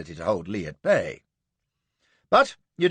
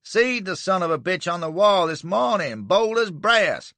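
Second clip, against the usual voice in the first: Southern accusation; 8 semitones higher, 4 dB louder than usual. Acted.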